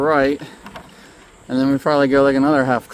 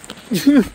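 breathless laugh; 10 semitones higher, 5 dB louder than usual. Real, not acted. Laughing at the steep hill.

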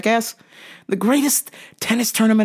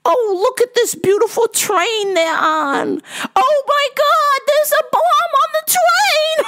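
put-on very high, drawn-out falsetto old lady; hammed-up fretful old woman; old lady moviegoer yelling; slower than usual. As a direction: squeal warnings at the movie screen. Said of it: "yelling at the theater".

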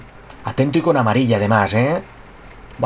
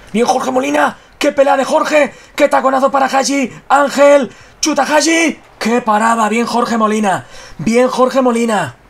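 excited commentary; 8 semitones higher than usual. Real, not acted. Live FIFA play commentary, shouting.

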